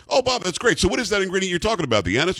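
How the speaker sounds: mock innocent. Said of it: "I'm like".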